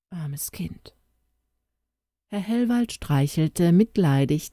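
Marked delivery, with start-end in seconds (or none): 0.1-0.9 soft pitying whisper. Acted. Gentleman pities the orphaned girl, whispering. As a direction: breathe it out in a whisper.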